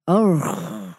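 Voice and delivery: low, guttural wolf grunt; a sleepy animal grunt on waking; deep rumbling urgh from the belly; wolf's waking grunt. A narrator's reading. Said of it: "said Father Wolf".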